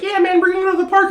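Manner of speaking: high, fast, loose casual chatter; mock the lazy off-air voice; off-camera casual voice. Mocking creators' off-camera voice to viewers, fast and high. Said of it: "they'll be off camera and they're like".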